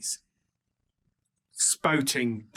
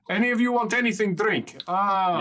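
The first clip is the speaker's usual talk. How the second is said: higher, fast, hearty offer; gruff host voice; 7 semitones higher than usual. Acted. Big scarred doorman offering the party drinks, hearty. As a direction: bustle through the offer of drinks. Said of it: "He says".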